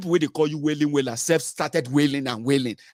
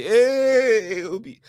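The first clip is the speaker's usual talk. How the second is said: mock cheer; 9 semitones higher, 4 dB louder, much slower than usual. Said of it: "I go say".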